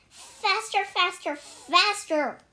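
high, loud urging cries; crying out. A narrator's reading. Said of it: "cried Owl".

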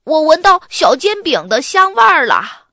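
loud, high excited holler. A narrator's reading. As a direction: call out eagerly on coming in. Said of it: "亚瑟进门就嚷着". (一进门就兴奋地大声嚷嚷)

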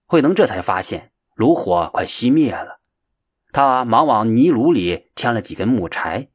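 ordinary delivery

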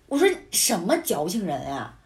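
ordinary delivery

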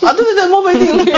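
high, loud sudden realization; excited recognition. Real, not acted. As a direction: burst out with relief. Sudden recall of the name.

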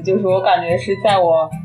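normal talking voice

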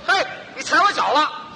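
ouch of surprise. Acted. Stepped-on passenger yelps at the careless rider, startled and pained. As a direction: cry out as if your foot was just stepped on.

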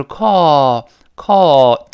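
American CALL, long sighing open vowel. Shown as a demonstration. Teacher contrasts accents, American CALL drawn out like a sigh. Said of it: "美国变成叹气音".

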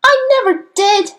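high, loud schoolboy protest; shrieked-up indignant denial; exclaimed denial. A narrator's reading. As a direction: cry out in alarmed innocence. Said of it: "the boy exclaimed".

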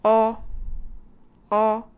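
loud, rounded 哦 as a pure o; Spanish vowel o. Shown as a demonstration. Teacher modelling the Spanish vowel o, loud and round. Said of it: "第四个是".

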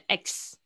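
X said "eks", with an open e; imitated wrong vowel. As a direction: mimic the learner's open-e "eks" for X. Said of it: "你会念成X". (模仿学生把X念成"eks")

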